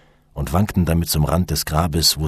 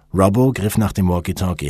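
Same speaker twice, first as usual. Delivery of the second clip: ordinary delivery, nothing marked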